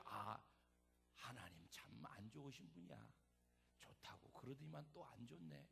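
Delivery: low, soft grumbling to oneself; acted, deflated complaint under the breath; disappointed grumble. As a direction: sulk quietly, as if thinking aloud. Imagined disappointed grumble at God.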